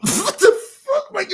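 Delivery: high, slow disbelieving what the fuck; incredulous. Real, not acted. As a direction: sound stunned and amused. Incredulous reaction.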